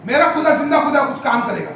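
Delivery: fiery pulpit retelling; forceful preaching. Acted. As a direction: preach the story at full force, voicing each character. Preaches the Naaman story forcefully.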